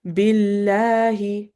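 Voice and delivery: بالله with a light ba and a dropped jaw; بالله said lightly, jaw open wide. Shown as a demonstration. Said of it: "ba more lighter and drop your jaw".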